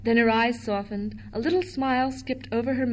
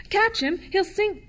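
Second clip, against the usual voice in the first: high, loud alarmed call; urgent cry; 9 semitones higher, 3 dB louder than usual. A narrator's reading. Michael as the dolphin slips away, urgent cry. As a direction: cry out a sudden warning. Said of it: "cried Michael quickly".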